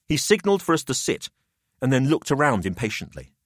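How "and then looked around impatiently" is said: plain reading voice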